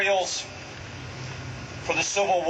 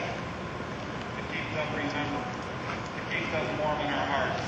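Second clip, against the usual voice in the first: soft solemn remembrance; tender and soft; 3 semitones lower, 6 dB quieter than usual. Real, not acted. Solemn memorial words.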